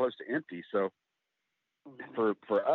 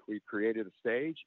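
normal talking voice

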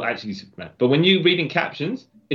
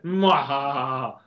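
slow hammed-up villain cackle; slow evil laugh; much slower than usual. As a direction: cackle slowly like a supervillain. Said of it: "full on, like, as though he's Doctor Doom".

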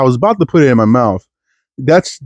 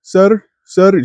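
high calling sir sir, imitating the kiosk lady; mimicking kiosk lady; 9 semitones higher, much slower than usual. Comedian imitating the kiosk lady calling him, high mock call. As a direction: call out sir like a pushy saleswoman. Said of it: "she was like".

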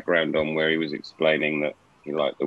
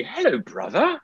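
lightly put-on British greeting, higher; a hint of a British accent, said higher; 13 semitones higher than usual. Friend greets a fellow Brit in a playful British accent. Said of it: "my British accent sucks".

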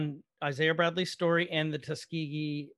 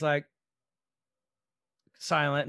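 ordinary talk, nothing marked

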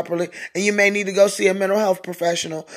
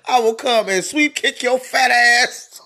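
usual speaking voice

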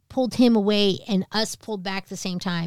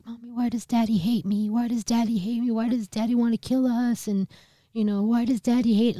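put-on higher-pitched little kid repeating a plea; lightly voiced distressed child, over and over; upset child pleading; 5 semitones higher than usual. Little kid asks mom why daddy hates them, upset pleading. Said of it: "saying it over and over again".

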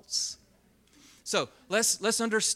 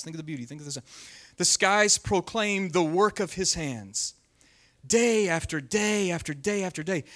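dramatic scripture reading; 6 dB louder than usual. Acted. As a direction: declaim the psalm to the room. Reads psalm aloud with force.

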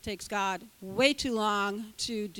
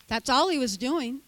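ordinary delivery

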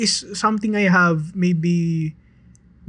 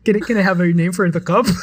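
normal talking voice

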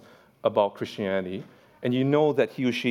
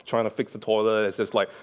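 normal talking voice

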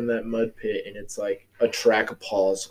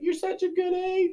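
much higher, softer mock of wife; high sweet mock wife; 4 dB quieter than usual. Wife praising him for the dishes, in high mock voice. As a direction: coo it like the wife, pitched way up. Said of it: "she's like".

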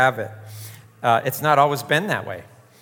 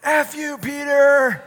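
put-on high, childish yell of F you, Peter; little kid cursing; 13 semitones higher, 6 dB louder, slower than usual. A four-year-old neighbor yells at Peter, high childish shout. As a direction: play a tiny kid shouting a curse. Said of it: "this little kid, just like".

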